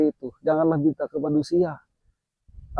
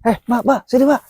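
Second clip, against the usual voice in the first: high, carrying call to someone far; 9 semitones higher than usual. Real, not acted. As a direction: call her over from a distance. Calling out to a stranger.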